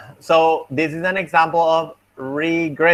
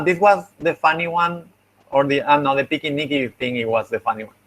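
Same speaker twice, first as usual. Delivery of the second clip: ordinary talk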